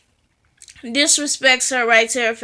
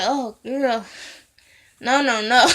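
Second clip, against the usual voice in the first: laughing disbelief. Real, not acted. Vlogger reacting to the character, laughing no. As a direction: shake your head at her, laughing. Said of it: "And I'm like".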